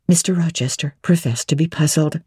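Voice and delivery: ordinary delivery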